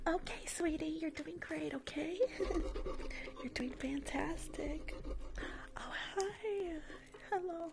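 fake sweet. Nail tech suddenly sweet to her client as the boss walks in. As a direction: turn on the charm for the boss.

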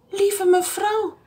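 high, slow gentle policeman voice; a lightly voiced friendly officer; storybook policeman, high and slow; kind policeman. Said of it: "zei de agent".

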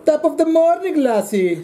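Israelish: mock Irish through an Israeli accent. Greeting the host as a leprechaun in Israeli-Irish accent. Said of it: "an Israeli Irish accent".

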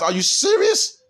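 shoots way up high in disbelief; can't believe what he's hearing; incredulous. Real, not acted. Preacher scoffing at tattooed women, high incredulous.

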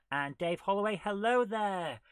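loud, high, rising hello; big sing-out greeting; calling out greeting. Real, not acted. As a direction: hail them loudly across the room. Calling out greeting to chat viewer.

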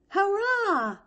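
high-pitched, loud mock cheer; imitated high hurrah; mock yell. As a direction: cry it out like a cheer. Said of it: "he was going to yell".

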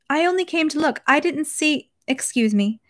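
high-voiced, apologetic wife. A narrator's reading. Wife apologises to displeased husband.

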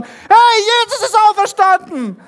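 very loud, high-pitched jubilant yell; excited shouting. Acted. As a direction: shout over them with the same news. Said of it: "schreien die schon".

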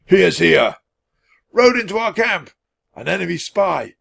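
high, loud alarmed cries; yelling. A narrator's reading. Horsemen raising the alarm, yelling. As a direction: yell like a roused troop. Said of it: "all began yelling at once".

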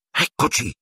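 breathless urgent whisper, no voice. Acted. Hermione spotting them from hiding, whispering. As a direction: hush it out so nobody hears. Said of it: "sussurrò Ermione".

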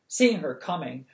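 plain reading voice

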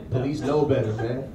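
ordinary talk

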